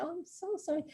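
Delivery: meek apology. Retold or imitated. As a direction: shrink back and apologize. Apologizing to the angry guard.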